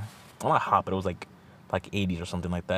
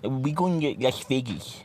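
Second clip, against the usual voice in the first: higher, slow smug announcement; teasing friend voice; 5 semitones higher, slower than usual. Retold or imitated. Friend filming his face, teasing about Vegas in a higher, slow voice. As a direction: play the smug teaser announcing it.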